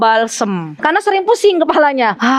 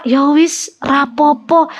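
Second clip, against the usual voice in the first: mock resigned grandma; 2 semitones higher, much slower than usual. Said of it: "kalau denger apa".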